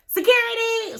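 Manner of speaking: very high-pitched call for help; imitated Karen. [name] calling security like a Karen, imitated Karen. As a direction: mimic a Karen calling out in alarm. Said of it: "she was like a Karen".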